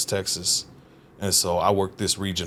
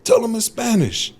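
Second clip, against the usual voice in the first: much higher demanding mock order; foreman's demand; 13 semitones higher, 5 dB louder than usual. Foreman demanding a Spanish translation, higher mock order. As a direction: insist like the annoyed boss. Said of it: "He's like".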